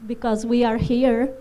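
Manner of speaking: usual speaking voice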